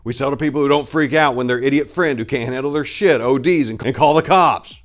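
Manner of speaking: loud, high, hard-driving question; rhetorical demand. A narrator's reading. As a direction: push the point hard and loud. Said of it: "he asked rhetorically".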